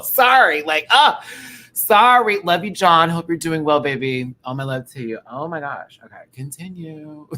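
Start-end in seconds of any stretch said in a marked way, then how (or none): 0.0-4.3 loud, high, gushing apology and affection; emotional. Real, not acted. Streamer sending love to John, loud and overcome. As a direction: blurt it out, overcome with feeling. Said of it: "I'm getting emotional".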